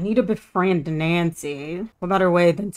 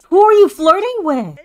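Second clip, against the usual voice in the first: higher and louder, indignant; mock outraged surprise; jealous outcry; 10 semitones higher, 7 dB louder than usual. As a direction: call out the cheater.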